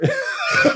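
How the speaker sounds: real high-pitched laugh. Real, not acted. Laughing at his own story.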